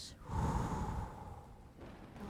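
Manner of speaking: long, audible breath out; demonstrated exhale. Shown as a demonstration. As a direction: breathe out slowly to guide the listener. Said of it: "And breathe out".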